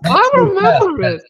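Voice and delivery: high, mocking echo of his protest; mimicking. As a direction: throw his words back in a high mock whine. Mimicking a younger co-host's protest.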